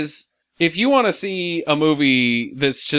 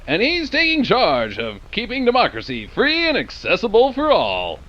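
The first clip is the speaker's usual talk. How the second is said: put-on peppy old-time radio host; kids show announcer; 6 semitones higher than usual. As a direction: hype up the kids at home. Mock kids' radio show announcer.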